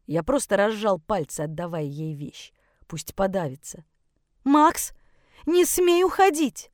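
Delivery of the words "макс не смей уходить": high, raised-voice order; desperate command. Acted. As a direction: forbid him to leave, high and forceful. Mother blocks the door, forbidding. (отчаянный приказ высоким громким голосом)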